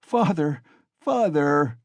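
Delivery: put-on a boy crying for his father; child's frightened cry. A narrator's reading. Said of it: "he cried".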